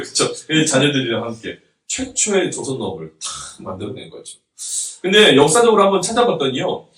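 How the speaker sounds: normal talking voice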